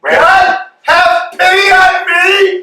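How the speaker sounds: high, loud pleading shout; desperate cry. Acted. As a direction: cry out to God for mercy. Praying while balancing on one leg.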